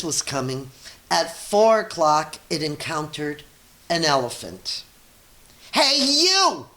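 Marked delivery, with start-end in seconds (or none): 5.7-6.7 high, loud challenge from a tiny bug; grouchy ladybug. A narrator's reading. Ladybug squaring up to the rhinoceros, high and loud. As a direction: squeak it out like a picking-a-fight bug. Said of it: "said the grouchy ladybug".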